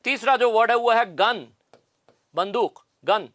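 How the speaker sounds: ordinary talk, nothing marked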